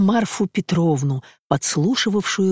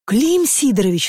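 losing patience; 5 semitones higher than usual. Acted. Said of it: "вскричал Блистовский, начиная терять терпение".

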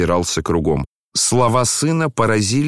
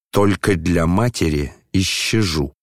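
ordinary delivery, nothing marked

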